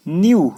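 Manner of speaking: loud model of न्यू with no K; silent K. Shown as a demonstration. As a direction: say न्यू as "nyoo", K unheard. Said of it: "के silent है ना".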